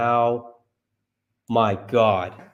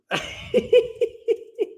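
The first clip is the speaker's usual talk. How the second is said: squeaky breathless laugh; a high airy giggle at the news. Real, not acted. Laughing at Uber's stock price, high giggle.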